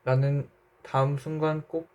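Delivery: plain reading voice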